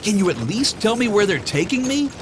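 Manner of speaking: fast pleading question; quick, worried ogre voice; hurried Shrek. A narrator's reading. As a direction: rush it out anxiously. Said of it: "Shrek asked him".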